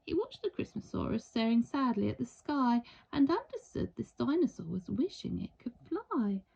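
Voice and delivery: usual speaking voice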